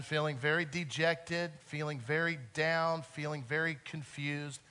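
usual speaking voice

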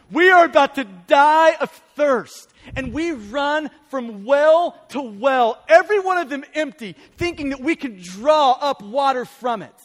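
loud, slow, heavily punched sermon delivery; impassioned preaching. Real, not acted. Preacher picturing people running from empty well to well, impassioned preaching. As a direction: drive each phrase out forcefully, stretching the key words.

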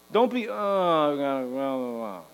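low, slow bored blah blah; sloth drawl. Shown as a demonstration. Preacher warns against laziness, slow bored droning blah. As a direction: drone it out like a lazy sloth. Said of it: "Don't be a sloth".

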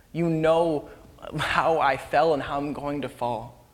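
slow, heartfelt confession; weighted, deliberate declaration of love; broken, sincere. Acted. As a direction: plead earnestly, with long pauses. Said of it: "he's broken".

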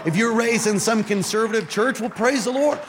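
high-pitched, urgent sermon delivery; impassioned preacher building an exhortation; fervent preaching. Real, not acted. Preaches at full force.

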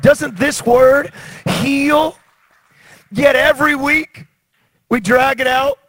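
high, loud and slow, driving each phrase home; rising fervent sermon cry; full-force preaching. Acted. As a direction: preach it like a revival call to the water.

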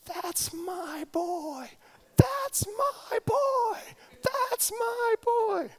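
high, far-carrying cheering shout; screaming mom. Retold or imitated. Mother cheering her son from the stands, high excited shouting. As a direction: yell it from the stands like a proud mom. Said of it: "she starts screaming".

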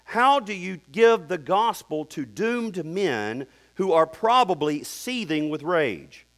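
ordinary delivery

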